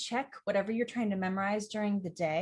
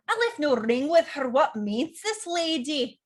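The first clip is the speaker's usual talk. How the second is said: louder Scottish accent, lines rolled out; 5 semitones higher, 5 dB louder than usual. Shown as a demonstration. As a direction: play it as a proud Scot. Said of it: "This can be accents like Scottish".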